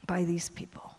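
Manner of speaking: breathy whisper, almost no voice; whispered aside. Shown as a demonstration. Whispering that her nation adopted her. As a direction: drop it to a confiding whisper.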